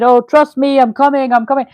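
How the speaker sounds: mock reassurance. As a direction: playfully voice him promising he's coming. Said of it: "keeps saying".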